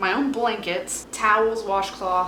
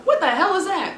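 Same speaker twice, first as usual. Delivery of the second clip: high, louder horrified question; sudden louder, higher disgust; shocked dismay; 10 semitones higher, 4 dB louder than usual. Retold or imitated. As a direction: recoil at what you just unwrapped. Unboxing disappointing shirt.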